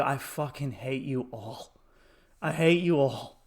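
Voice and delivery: understated retold loathing; muttered hatred. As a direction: let it out quietly, under your breath. Retold aside to the audience.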